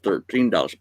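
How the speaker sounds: ordinary talk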